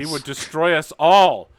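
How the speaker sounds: loud, high-pitched impassioned warning; dramatic outcry. Acted. Uncle arguing with Everyman over the rules, impassioned outcry. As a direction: cry it out with theatrical alarm. Character pleading with Everyman in acted quarrel.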